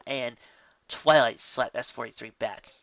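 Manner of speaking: ordinary delivery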